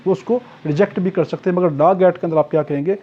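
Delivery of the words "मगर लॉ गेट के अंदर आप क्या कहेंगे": usual speaking voice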